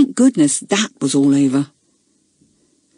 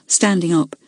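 ordinary delivery, nothing marked

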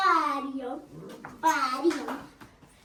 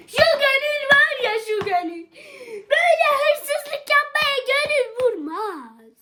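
hammed-up wailing; 9 semitones higher, 11 dB louder than usual. Thief mock-confessing guilt, hammed-up crying wail. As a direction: wail about the conscience like a melodrama.

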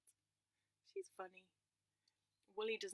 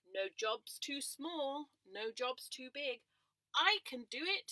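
slow, louder pig voice; slow proud pig; 3 semitones higher, 9 dB louder, much slower than usual. Acted. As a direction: boast slowly like a hearty pig. Missus Pig volunteers.

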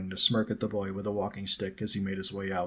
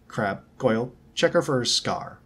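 louder, commanding tone; ordering; 3 semitones higher, 6 dB louder than usual. A narrator's reading. Malfoy sends Crabbe and Goyle at Harriet, ordering. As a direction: give a firm order to henchmen. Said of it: "Malfoy ordered".

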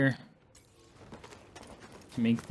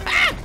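high pained yelp; 6 dB louder than usual. Real, not acted. Getting hit in game.

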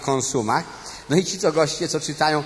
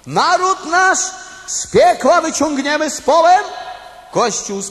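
pompous proclamation; 9 semitones higher, 5 dB louder than usual. Acted. Reading plaque motto solemnly.

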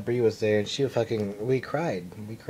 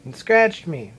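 higher, louder indignant retort; angry complaint; 8 semitones higher, 7 dB louder than usual. Retold or imitated. Friend explaining why she kicked the cat, loud and angry. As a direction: fire back hurt and furious. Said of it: "She's like".

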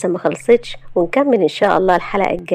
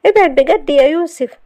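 high, loud upset plea; 9 semitones higher, 5 dB louder than usual. A narrator's reading. Shahd in tears telling Youssef to back off, upset. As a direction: push away with pitch raised, near tears. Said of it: "لما لقى دموعها فرت من عينيها".

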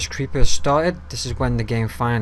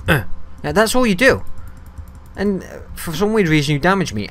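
higher, louder exasperated rant; fed-up rant; 6 semitones higher, 6 dB louder than usual. Real, not acted. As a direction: tell the mob off, fed up. Angry at attacking mob.

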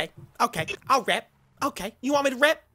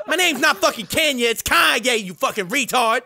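a put-on Kanye voice, brash and cocky; [name] impression; 2 semitones lower than usual. Trash-talking strangers in game chat, brash Kanye voice. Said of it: "You guys wanna hear my real voice?".

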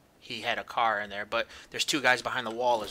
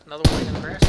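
excited shout; 6 semitones higher, 14 dB louder, much faster than usual. Real, not acted. Spotting enemies in game.

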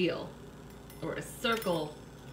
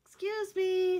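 high call out the window; 12 semitones higher, slower than usual. Acted. Hen calling out the window to the animals, high. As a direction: call to get the neighbours' attention.

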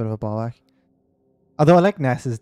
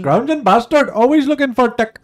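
put-on much higher, much louder Indian Scottish accent yell; 11 semitones higher, 9 dB louder than usual. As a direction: shout it as the Indian Scottish shopkeeper from Still Game. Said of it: "He's like an Indian Scottish guy".

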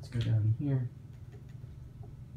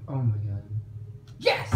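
usual speaking voice

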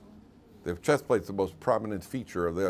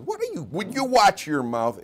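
high, slow mock-outraged scolding; indignant rebuke; 8 semitones higher, 3 dB louder than usual. Moshe scolding a man for his question, indignant. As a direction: act taken aback and scold him.

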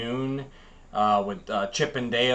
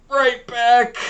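high, loud cartoon kid voice; 10 semitones higher, 7 dB louder than usual. Little cartoon kid after a crash, high loud. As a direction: pop it out like a dazed cartoon kid. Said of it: "hit something and go".

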